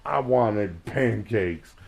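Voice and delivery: mock-whine it like a teenager ranting about her dad; whiny teen complaint. Daughter griping to friends about crepes, loud mock whine. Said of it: "she was like".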